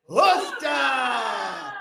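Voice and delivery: "Ufta" shouted loud with a lift at the end; group shout. Shown as a demonstration. Auctioneer leading guests in a shout, loud "Ufta". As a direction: yell "Ufta" to let the year go. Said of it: "So here we go. One, two, three".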